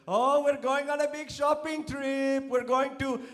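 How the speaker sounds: high, loud, slow Bangladeshi accent imitation; hammed-up Bangladeshi accent mother-in-law. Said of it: "they have an awesome accent".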